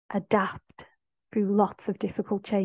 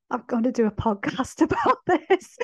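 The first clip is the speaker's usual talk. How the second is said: higher, laughing through the words; laughing plea; 4 semitones higher, 6 dB louder than usual. Retold or imitated. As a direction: break up laughing at your own panic. Imagined plea to Queen, laughing.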